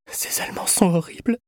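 high, whispery murmur. A narrator's reading. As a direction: murmur it in a thin, frail voice. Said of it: "murmura-t-elle".

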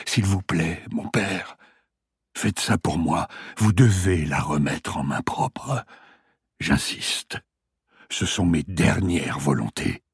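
a hint of a weak old patient; dying man voice.

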